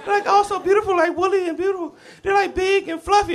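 put-on very high, pleading kid voice defending her caterpillars; crying little sister. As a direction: wail that they're beautiful. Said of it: "now Angie's crying".

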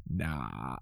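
low, flat, uninterested nah; bored dismissal. Retold or imitated. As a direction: brush it off lazily. Hero turns down offers.

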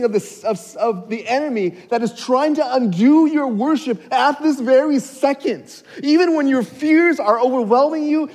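high, loud, impassioned preaching; excited preaching. Real, not acted. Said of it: "I'm getting too excited here".

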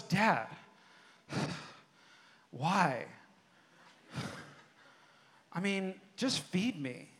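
imitated sulky child, sighing between phrases; hammed-up reluctant complaining with heavy sighs; grumbling kid. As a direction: sigh and grumble softly like a put-upon son. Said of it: "he's, like, grumbling about it".

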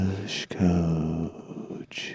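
whispered, spooky calling; creepy whisper. Pretend. Cohost whispering to scare a chat viewer. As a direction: lure him in a hush. Creepy call to Kush Coach.

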